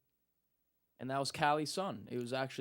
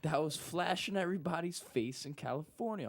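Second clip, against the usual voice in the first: caller voice; 3 semitones higher than usual. Retold or imitated. Caller repeats odd slow question.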